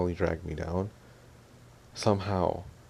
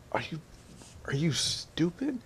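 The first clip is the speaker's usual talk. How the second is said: mock-incredulous question, stretched out; 9 semitones higher than usual. Incredulous reaction to the girl.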